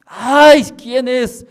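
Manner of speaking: high, loud whiny complaint; woman woken at night, high and loud; annoyed sleeper. Retold or imitated. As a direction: push the voice away, half asleep. Woman startled.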